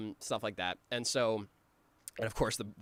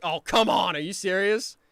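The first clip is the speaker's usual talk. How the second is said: loud, higher indignant outburst; mad Riggy; 7 semitones higher, 10 dB louder than usual. Retold or imitated. Riggy losing at checkers to Billy, loud indignant outburst. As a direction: blow up at the loss. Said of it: "And then Riggy's mad".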